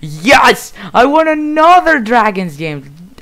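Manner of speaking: loud, high victory cheer with a laugh; triumphant shout. Real, not acted. Player celebrating a Dragons win, triumphant. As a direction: shout it out, thrilled to have won.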